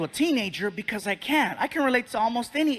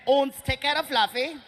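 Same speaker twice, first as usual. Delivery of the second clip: mock German airline staffer; German accent; 4 semitones higher than usual. German airline staff passing word about a passenger, mock German accent. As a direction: put on a German accent, a little higher. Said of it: "felt like they phoned ahead".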